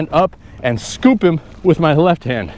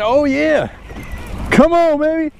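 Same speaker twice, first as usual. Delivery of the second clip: excited, pitched-up urging; fighting a fish; 11 semitones higher than usual. Real, not acted. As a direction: coax the fish in eagerly. Reeling in the fish.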